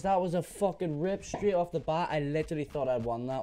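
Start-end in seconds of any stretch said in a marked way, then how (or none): none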